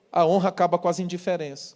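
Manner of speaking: usual speaking voice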